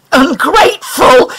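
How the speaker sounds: stunned echo of the insult; shocked. A narrator's reading. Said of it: "he said, completely shocked".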